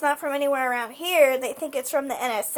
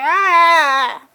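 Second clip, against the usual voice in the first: short loud frustrated shriek, imitating the girl; a quick acted-out yell of frustration; frustrated cry; 4 semitones higher, 10 dB louder than usual. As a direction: let out a loud exasperated "Ah!". Girl pulling her hair out.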